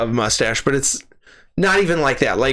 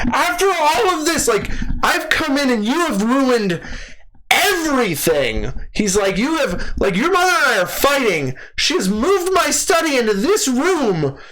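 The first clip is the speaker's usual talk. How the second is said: high, strained ranting of a defeated intellectual; broken man's rant; 12 semitones higher than usual. Retold or imitated. The dad ranting at his daughter about the ruined house, high and anguished. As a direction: rant like a man whose life fell apart. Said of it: "rant mode of a broken man".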